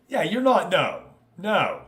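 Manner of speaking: usual speaking voice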